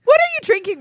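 high, loud teasing call. Real, not acted. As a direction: shout the question across. Shouting to a guest.

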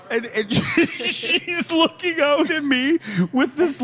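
high, slow, giggle-choked telling; squeaky with laughter; laughing hard. Real, not acted. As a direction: struggle through the words while laughing. Teller laughing as story peaks.

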